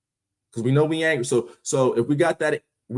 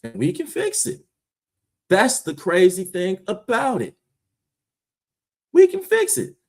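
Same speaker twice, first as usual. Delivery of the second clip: high passionate cry; 7 semitones higher, 3 dB louder than usual. Real, not acted. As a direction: voice leaps up, pleading. Passionate plea.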